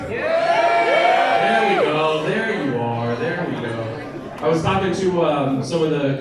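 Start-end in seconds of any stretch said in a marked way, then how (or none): 0.1-4.4 crowd call. Real, not acted. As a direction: draw the whole room in with a long call. Calling out to the crowd.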